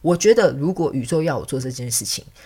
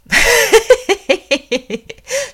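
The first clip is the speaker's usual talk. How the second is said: high, loud evil cackle; sinister laugh; 9 dB louder than usual. Acted. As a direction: cackle like a scheming villain. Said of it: "你知道我奸笑是为了什么".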